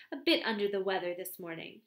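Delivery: ordinary delivery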